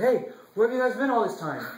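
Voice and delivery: king's indignant call to his late servants; yelling. Acted. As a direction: yell at lazy servants. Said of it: "yelling at his servants".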